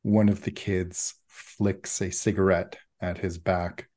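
usual speaking voice

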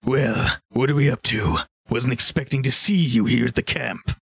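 lightly voiced ogre; a slightly put-on character voice; ogre host. Ogre surprised by visitors at the camp, lightly voiced. Game master plays ogre Sirach.

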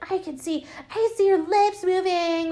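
high, put-on bratty kid voice; ten-year-old boy. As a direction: taunt like a little boy heckler. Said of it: "like, ten-year-old, twelve-year-old boy".

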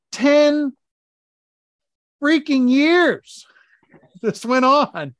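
loud, high exasperated outburst, laughing through it. Real, not acted. Husband venting to the host about years of stolen covers, loud and exasperated. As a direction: vent years of frustration with a laugh.

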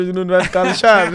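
shouting it out playfully; loud, gleeful teasing warning. Real, not acted. Teasing threat shouted to host.

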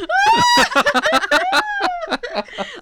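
loud high shrieking laughter. Real, not acted. Laughing as the tip falls.

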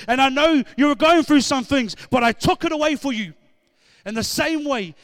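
loud, rising preacher's cry, driving each line home; full-force preaching. Real, not acted. Preacher rousing the church to praise, loud, fervent preaching. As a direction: preach it hard to the congregation.

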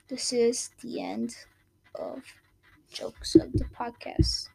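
slow, hushed announcement; dramatic whisper. Acted. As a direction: whisper it like a solemn ending.

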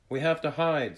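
high, loud and urgent; urgent warning. A narrator's reading. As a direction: call out a warning to a friend. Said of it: "Skylar said".

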